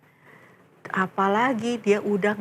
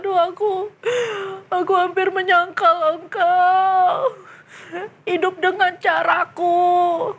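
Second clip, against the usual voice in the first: tearful confession; 8 semitones higher than usual. Acted. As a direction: confess through tears. (pengakuan sambil menangis)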